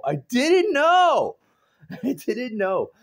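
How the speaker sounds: high, loud and slow, drawn out; exaggerated disbelief. Vlogger recalls the girl's surprise crush, high loud disbelief. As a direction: stretch it out in mock shock. Repeated for comic effect.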